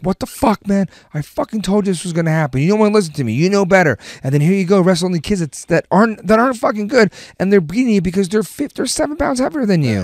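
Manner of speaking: high-pitched exasperated lecture; retold dad scolding. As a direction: chew out a kid who didn't listen. Dad scolding son after loss.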